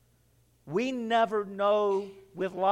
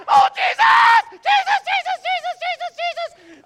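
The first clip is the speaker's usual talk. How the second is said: much higher, loud, fading panicked screams of the name; screaming in terror; 8 dB louder than usual. Retold or imitated. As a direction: shriek as the coaster plunges. Coaster plunging, screaming.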